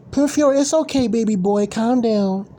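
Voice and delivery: high sing-song mockery of fans soothing a grown man; mock doting mom. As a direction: coddle him like an overprotective mother. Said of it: "like they're his mom".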